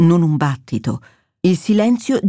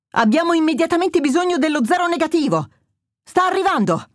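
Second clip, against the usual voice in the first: much higher-pitched, pressing urgency; urgent; 9 semitones higher than usual. A narrator's reading. Trauma team urgently calling for blood for a bleeding patient, high and urgent. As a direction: call it out like an emergency order.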